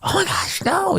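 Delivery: mock-shocked little voice for the dog; high whispered mock dismay; dog's mock horror. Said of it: "she's not like".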